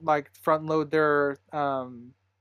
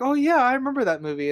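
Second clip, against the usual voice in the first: quick, higher-pitched imitation of mom; imitating mother; 9 semitones higher, 4 dB louder, faster than usual. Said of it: "She's like".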